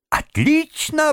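high, drawn-out cry of delight. A narrator's reading. Gentleman hears the washerwoman feels better, delighted. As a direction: exclaim it slowly and high, thoroughly pleased. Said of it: "обрадовался джентльмен".

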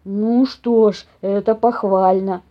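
very slow bear voice; approving bear. A narrator's reading. Papa bear praising the mouse, slow and approving. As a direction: approve it ponderously. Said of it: "добавил он".

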